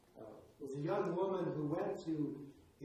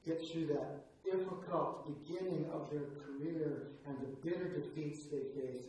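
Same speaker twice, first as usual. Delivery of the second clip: ordinary talk, nothing marked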